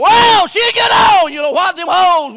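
loud, high wail into shouting; full-force preaching. Real, not acted. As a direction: draw out the first cry, then holler on.

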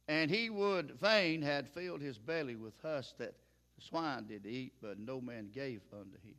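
measured, solemn Bible reading to the congregation; scripture reading. Acted. Preacher reading Luke to the church, solemn and measured.

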